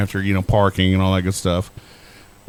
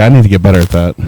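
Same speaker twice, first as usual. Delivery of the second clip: ordinary talk, nothing marked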